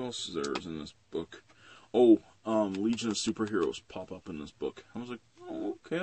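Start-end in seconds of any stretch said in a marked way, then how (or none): 5.4-6.0 reluctant high little "okay"; high-pitched reaction. Retold or imitated. Reviewer recalling his reaction to the book, squeaky. Retelling own hesitant reaction.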